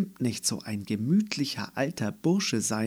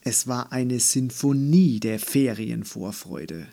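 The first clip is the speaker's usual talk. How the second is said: usual speaking voice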